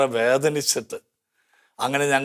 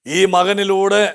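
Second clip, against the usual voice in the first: higher, fervent preacher voice; impassioned preaching; 6 semitones higher, 4 dB louder than usual. Acted. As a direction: rouse the congregation with rising fervour. Preaching Bible story. (ഉയർന്ന സ്വരത്തിൽ ആവേശത്തോടെ പ്രസംഗം)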